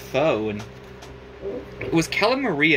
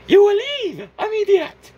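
much higher and louder squeaky command; bossy rat shout; 7 dB louder than usual. Acted. Rat ordering Cuphead out of his own house, shrill shout. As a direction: shriek the order at him.

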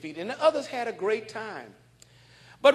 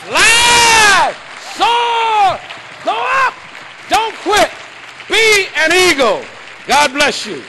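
high, loud shouting exhortation; preaching at full force; 13 semitones higher, 14 dB louder, slower than usual. Acted. Preacher rousing the crowd to be eagles. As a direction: whip the congregation up.

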